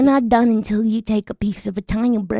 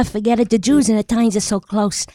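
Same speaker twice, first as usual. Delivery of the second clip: ordinary talk, nothing marked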